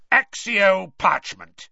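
loud, slow incantation; commanding spell cast. Acted. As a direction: call the spell out with force. Moody casts summoning spell.

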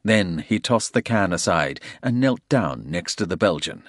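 usual speaking voice

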